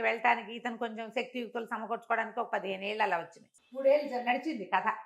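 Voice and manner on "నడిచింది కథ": plain reading voice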